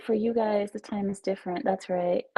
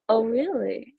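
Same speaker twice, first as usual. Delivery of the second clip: usual speaking voice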